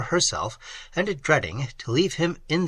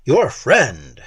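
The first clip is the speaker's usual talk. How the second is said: louder, sharp jealous retort; hasty retort; 7 dB louder than usual. A narrator's reading. A jealous lover retorting hastily, louder. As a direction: snap the words back. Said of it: "said Valancourt hastily".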